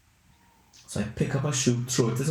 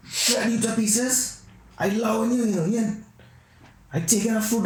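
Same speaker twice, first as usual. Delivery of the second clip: raccoon voice; 10 semitones higher than usual. Pretend. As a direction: threaten the man like a cocky raccoon, higher. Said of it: "he probably thinking".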